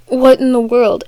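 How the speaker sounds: ordinary delivery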